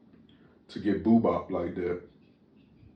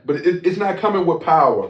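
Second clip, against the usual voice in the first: loud; 9 semitones higher, 10 dB louder than usual. Real, not acted. Demanding a harder high five.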